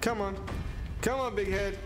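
normal talking voice

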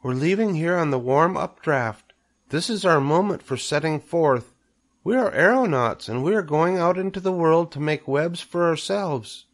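high, small creature voice; a tiny spider announcing, pitched well up; little spider. A narrator's reading. Spiderling tells Wilbur of setting forth, high little voice. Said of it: "one little spider took time enough to stop and talk".

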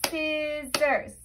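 "Scissors" in two drawn-out, separate beats; syllable by syllable. Shown as a demonstration. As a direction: chant "Scissors" evenly, a beat for each syllable. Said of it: "Let's try it again".

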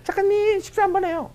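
very high-pitched, sweet caller impression; 서수민. Former colleague phoning the writer for a meal, high mimicked voice. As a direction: squeal a friendly invitation. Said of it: "수민이가 저한테 전화 와요".

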